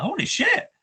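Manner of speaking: high-pitched retold amazement; retold shock. Viewer retelling seeing the character appear, retold shock.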